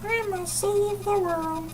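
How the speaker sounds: high, slow whining hero; whiny hero voice. Retold or imitated. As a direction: whine it like a self-important hero. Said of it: "the whiny hero voice".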